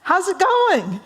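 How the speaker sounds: very high, drawn-out hello; romantic's greeting. Acted. Joe brings the fax to Bob's office, singsong greeting. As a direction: sing out a hammed-up cheery greeting. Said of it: "Joe says".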